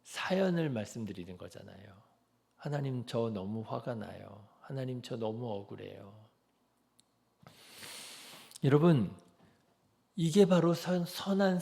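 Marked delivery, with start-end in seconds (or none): 2.6-6.3 quiet, earnest plea to 하나님; softer voice, a touch of hurt; soft plaintive prayer. Shown as a demonstration. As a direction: confide the hurt softly to God. Preacher voices believer praying.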